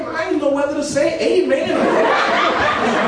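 high, loud, laughing through every word; real laughter. Real, not acted. Preacher laughing hard at the classmates calling him a preacher. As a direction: crack up while you tell it. Teller laughs retelling the girl's remark.

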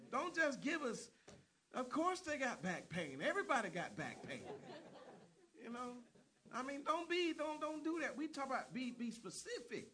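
high, loud preaching voice; fiery preaching. Acted. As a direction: push the congregation hard. Preacher exhorts the congregation.

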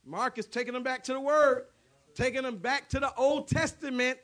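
loud, high, ringing pulpit preaching; impassioned revival sermon voice; full-force preaching. Acted. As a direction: preach it out to the whole congregation.

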